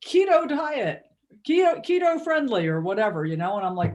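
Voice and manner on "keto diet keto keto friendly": higher, slow mocking label voice; mock the package's hype; mocking sales pitch. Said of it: "but it was like".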